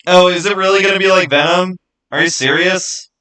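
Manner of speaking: ordinary talk, nothing marked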